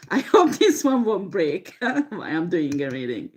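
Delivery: amused, with a laugh breaking through; real laughter leaking into the words. Real, not acted. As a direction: giggle partway through the sentence. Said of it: "Sorry for laughing at".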